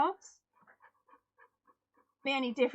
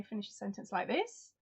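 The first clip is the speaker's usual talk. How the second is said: "this" lifted sharply like a question; Australian rising ending; 2 semitones lower, 4 dB quieter than usual. Shown as a demonstration. Essex streamer imitating Aussie mates, rising sharply on "this". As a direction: finish on "this" with a questioning upswing. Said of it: "they go higher at the end of sentences".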